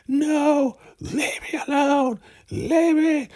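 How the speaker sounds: very high squeaky crying voice; crying little boy. Retold or imitated. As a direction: squeal it like a terrified child. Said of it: "the highest is tripping".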